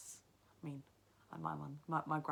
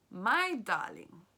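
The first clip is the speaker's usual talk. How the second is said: put-on louder, slow old woman voice; grandmother; 3 semitones higher, 10 dB louder, much slower than usual. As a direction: address the boy as grandma. Grandmother answers.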